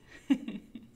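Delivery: short chuckling giggle; amused laugh. Real, not acted. Reader amused by the disobedient pet, short giggle.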